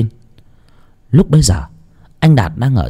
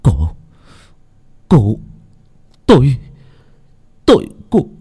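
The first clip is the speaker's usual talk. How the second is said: through gritted teeth; 2 semitones higher than usual. Acted. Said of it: "Anh nghiến răng".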